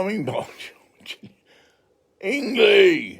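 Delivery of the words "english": loud, high cry of English!; indignant exclamation. Retold or imitated. To French cabin crew, a loud insistent English!. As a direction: call out English! with a rising protest.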